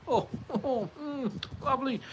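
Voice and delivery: high, mock-swooning over sausages; drooling over tasty sausages; savouring delight. Real, not acted. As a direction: coo with pleasure at the thought of them.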